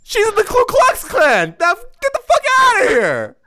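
very high, loud screaming voice; shouting. Pretend. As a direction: screech it out in a high voice. Acting mom shouting nudist parents away.

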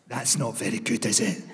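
low, soft, glum Glasgow woman; deadpan, disappointed coffee moan; moaning middle-aged woman. Retold or imitated. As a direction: grumble it into the cup like a disappointed auntie. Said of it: "the first one takes a sip and goes".

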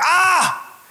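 put-on sudden high "Ah!" of a frustrated toddler; toddler's frustrated yell. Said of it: "He's like".

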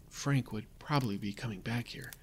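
ordinary delivery, nothing marked

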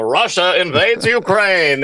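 put-on slow, dramatic old-time announcer; 1940s newsreel voice. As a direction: boom it like a wartime newsreel. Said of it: "the news reels that you would see".

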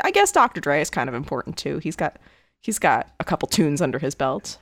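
low, offhand dry sarcasm; downplay it, dropping the voice; sarcastic understatement. Real, not acted. Co-host downplays [name]'s importance, dryly sarcastic. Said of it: "I say completely sarcastically".

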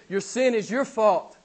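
ordinary talk, nothing marked

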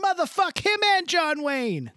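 high, hyped rap quote. Retold or imitated. Quoting a rap lyric, hyped and high. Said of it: "That's what [name] says in that song".

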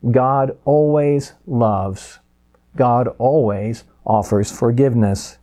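"always" drawn out and weighty; very slow, deliberate declaration; slow emphasis. Acted. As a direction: savor each word slowly. Slow emphatic summary line.

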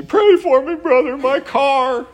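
high, loud, slow wail of alarm; mock panicked friend. Friend wailing to the pastor about his wrecked car, hammed up. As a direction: ham up a frantic plea for prayer. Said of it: "he didn't call me".

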